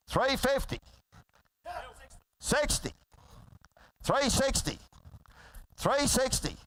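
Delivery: rapid rolling auctioneer chant; frantic trading floor shouting. Real, not acted. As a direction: rattle off the bids like an auctioneer. Auctioneer calling bids.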